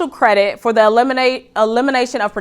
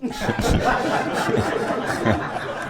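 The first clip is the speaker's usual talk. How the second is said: breathy laugh; 10 dB quieter than usual. Real, not acted. Laughs at own impression.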